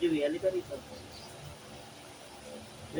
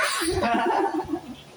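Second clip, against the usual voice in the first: playful laugh; 13 semitones higher, 6 dB louder than usual. Real, not acted. Tickling the child playfully.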